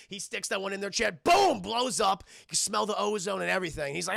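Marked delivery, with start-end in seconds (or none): 1.2-1.6 loud, high sudden boom; punchy sound-effect shout; imitated explosion. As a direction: burst out like a blown fuse. Imitating the fuse blowing.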